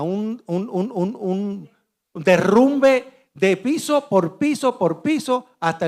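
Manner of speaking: slow drawn-out rumbling imitation of floors falling; slow imitated collapse. Survivor recreating the tower collapse for the audience, in slow imitated rumbling. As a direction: stretch it out like floors caving in one after another.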